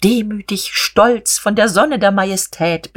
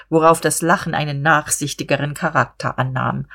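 ordinary delivery

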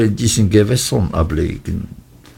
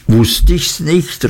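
higher, slow triumphant exclamation; exclaiming; 7 semitones higher, slower than usual. A narrator's reading. David exclaiming to Steerforth, slow and triumphant. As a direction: call out, pleased to be proven right. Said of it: "rief ich aus".

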